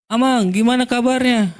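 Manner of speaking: bright called-out hello, well above his speaking voice; hearty high greeting. Retold or imitated. Pastor calling out to the church member, a hearty greeting.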